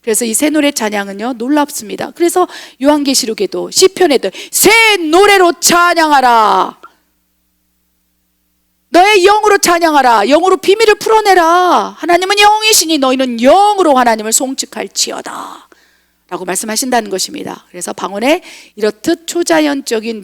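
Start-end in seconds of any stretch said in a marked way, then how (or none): 4.5-15.7 preaching proclamation. Acted. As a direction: proclaim it like a divine decree, stretching the words. Proclaims scripture as divine command.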